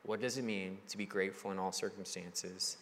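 soft, inward asking of does it mean; husband voicing what he and his wife ask; quiet sincere question. Real, not acted. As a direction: ask it low, almost to yourself. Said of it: "seriously asking ourselves".

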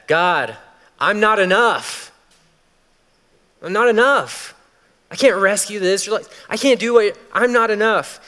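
high, loud, pleading; desperate cry. Retold or imitated. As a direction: cry out from the edge of fear. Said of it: "what we're screaming".

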